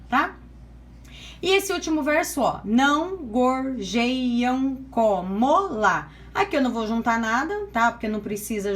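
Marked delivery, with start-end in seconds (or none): 2.7-6.1 "não gorjeiam como lá" very slowly, each syllable held apart; deliberate scansion reading of "não gorjeiam como lá"; separated syllables. Shown as a demonstration. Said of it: "Então, vamos lá separando".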